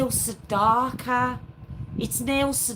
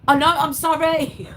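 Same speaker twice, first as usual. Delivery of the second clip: louder, higher, through a laugh; laughing apology; 6 semitones higher, 5 dB louder than usual. Real, not acted. Apologising to viewers while laughing. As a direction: giggle out the apology.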